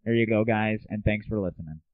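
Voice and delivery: ordinary delivery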